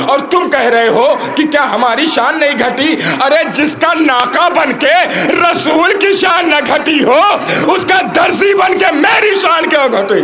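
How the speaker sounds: high, shouted sermon climax; full-force preaching. Acted. Preacher shouting Rizwan's answer to the angels, full force. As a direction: build to a roaring peak.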